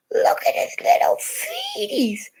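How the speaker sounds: put-on high cooing baby talk. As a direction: coo at the baby's feet. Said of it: "I said".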